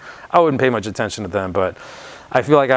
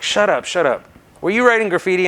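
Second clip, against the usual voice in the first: mimicked angry cop; 9 semitones higher, 3 dB louder than usual. Cop interrogating him, mimicked.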